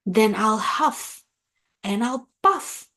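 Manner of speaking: a bragging wolf's threat, climbing; growling wolf. A narrator's reading. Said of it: "growled the big bad wolf".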